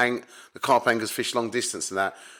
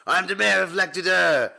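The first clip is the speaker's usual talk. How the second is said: higher, mocking imitation of a pompous boast; 7 semitones higher than usual. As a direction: parrot his brag with a sneer.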